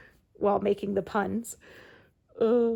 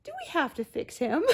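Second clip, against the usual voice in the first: Rowena asking Sam; 8 semitones higher than usual. Retold or imitated. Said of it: "she turns to Sam and says".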